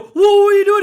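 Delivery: loud, high shocked "whoa"; alarmed protest. Retold or imitated. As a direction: act out recoiling from an unwanted kiss. Recoiling from attempted kiss.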